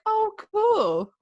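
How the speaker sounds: high-pitched clueless reply; imitating oneself being breezily clueless; naive mock enthusiasm. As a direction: play along brightly without understanding. Acts out her clueless past reply.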